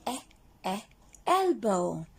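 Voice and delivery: usual speaking voice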